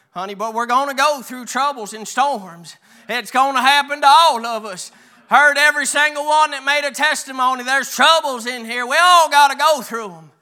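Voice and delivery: building to loud, high preaching. Real, not acted. Preacher warning church of troubles, preaching with rising force.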